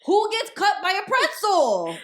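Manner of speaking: loud, high, drawn-out disbelief; hammed-up outraged disbelief, slow and high; mock incredulous shout. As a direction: yell it as an astonished dad. Acting the father's laughing disbelief.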